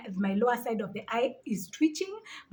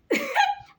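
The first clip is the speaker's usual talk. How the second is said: high burst of laughter; 4 dB louder than usual. Real, not acted. Laughing at eyelid myth.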